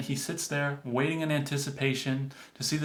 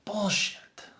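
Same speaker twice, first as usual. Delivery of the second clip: mock frustrated outburst; 7 semitones higher, 5 dB quieter, slower than usual. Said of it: "he's just saying to himself".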